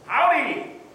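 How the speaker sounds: bright, high Southern greeting; a folksy stranger greets the table; friendly howdy. Retold or imitated. Stranger greeting a vacationing family, friendly and high. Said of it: "said a friendly voice".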